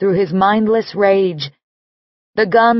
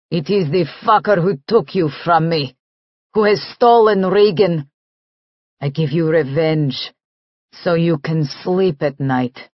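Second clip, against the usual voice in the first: put-on lower, agitated Russian accent; thick Russian accent; 3 semitones lower than usual. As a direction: press the Russian accent, insisting on revenge. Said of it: "his accent grows thicker".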